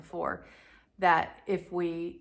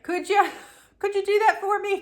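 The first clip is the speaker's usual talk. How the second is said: high, sugary asking voice with a laugh; much higher and louder, begging sweetly; sweet pleading; 14 semitones higher, 7 dB louder than usual. Retold or imitated. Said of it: "And I was like".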